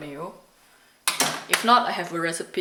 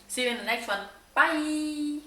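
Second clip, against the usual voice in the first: cheerful goodbye; 9 semitones higher than usual. Real, not acted. Cheerful sign-off to viewers.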